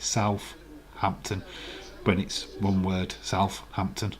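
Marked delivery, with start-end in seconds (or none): 0.0-1.4 slow South-hampton, the th and h both sounded; split into two words. Shown as a demonstration. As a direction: pull Southampton apart into South and hampton, slowly. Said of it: "That's how William writes it".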